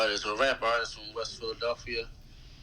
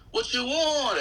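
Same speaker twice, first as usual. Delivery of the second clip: pitched way up, tossed off like a signature line; catchphrase call-out; 11 semitones higher, slower than usual. Retold or imitated. Rapper tossing out his everyday catchphrase, pitched high. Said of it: "'cause I keep saying".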